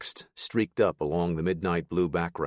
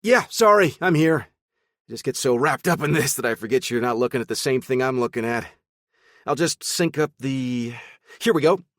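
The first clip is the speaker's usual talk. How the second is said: chipper, animated; 7 semitones higher, 6 dB louder than usual. Acted. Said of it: "chipper and animated".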